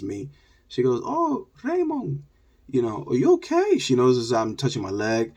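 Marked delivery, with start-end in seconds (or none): 1.0-3.8 fussing falsetto concern; concerned neighbour lady. Retold or imitated. Neighbour lady to hurt boy, high mock-concerned voice.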